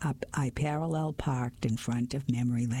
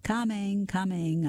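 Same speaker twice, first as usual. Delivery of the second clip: calling from below; 7 semitones higher than usual. Acted. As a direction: shout up from the basement. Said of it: "a muffled voice replied from ... somewhere below us".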